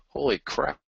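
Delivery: a high, hushed "holy crap"; stunned. Real, not acted. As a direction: genuinely caught off guard. Reacts to Canadian surge.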